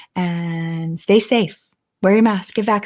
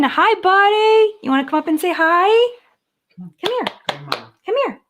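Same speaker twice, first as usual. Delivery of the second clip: much higher sing-song calling voice; calling the dog; 12 semitones higher than usual. Real, not acted. Owner coaxing her Brittany onto the couch, much higher calling. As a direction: coax the dog up with a high pet voice.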